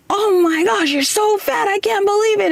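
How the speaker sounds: high, incredulous gush; mock the old friend's blunt amazement; imitating the friend. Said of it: "it was just like".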